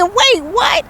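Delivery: high-pitched incredulous reaction; high, astonished double take; shocked disbelief. Retold or imitated. Retells her shocked reaction to grandson.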